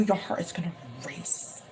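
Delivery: breathy hushed whisper. Acted. Reader whispering a prediction to the client. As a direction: lean in and whisper it.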